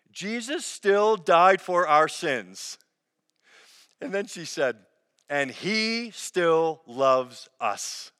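put-on slow and careful, a light touch of a small child; little girl's earnest answer. Pastor voicing a five-year-old's answer to her mom, slow and earnest. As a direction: say it like a five-year-old sure of her faith.

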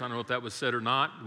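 ordinary talk

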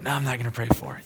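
second thoughts, rushed under the breath; hasty backing out. Retold or imitated. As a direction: talk yourself out of it. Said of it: "doing one of these where I'm like".

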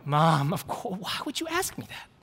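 mock incredulous protest leaping very high; exasperated son. Son responding to Mom the same way, exasperated squeak. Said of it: "I would respond in the same way".

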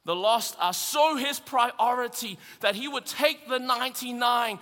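animated preacher voice; lively preaching. Real, not acted. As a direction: work the crowd with humor. Preaching over music.

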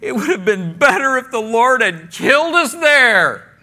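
high, loud hammed-up complaint; exaggerated moan of protest; mock moaning.

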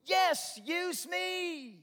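high, eager cry of devotion; excited, high-pitched acted plea; enthusiastic. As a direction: call out like a keen volunteer. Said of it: "we should be excited and we should be enthusiastic".

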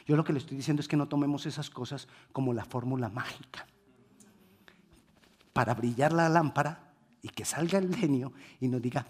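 ordinary talk, nothing marked

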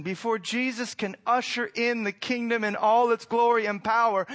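normal talking voice

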